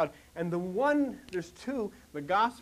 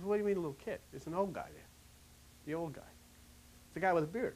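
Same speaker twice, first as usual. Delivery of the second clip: hushed retort; 2 semitones lower, 8 dB quieter, much faster than usual. Acted. John quietly corrects James in the boat, hushed retort. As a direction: answer back under your breath.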